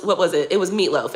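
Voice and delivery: usual speaking voice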